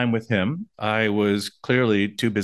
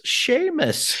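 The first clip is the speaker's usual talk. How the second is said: high, drawn-out playful hail; playful Irish-style name call. Pretend. Co-host introducing the local character Seamus, playful high call. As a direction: sing out the name, high and long.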